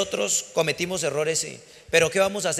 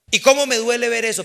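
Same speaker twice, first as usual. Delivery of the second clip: ordinary delivery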